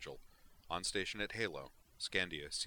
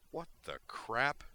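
slow, stunned what-the; startled outburst; 7 semitones higher, 4 dB louder, slower than usual. Acted. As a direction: caught off guard. Fighters suddenly surround him.

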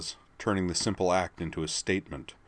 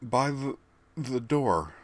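frightened stammer; 6 semitones higher, slower than usual. A narrator's reading. Terrified stammer.

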